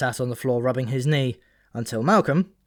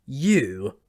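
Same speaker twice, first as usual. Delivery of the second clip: incredulous; 2 semitones higher, 3 dB louder than usual. A narrator's reading. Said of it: "he said incredulously".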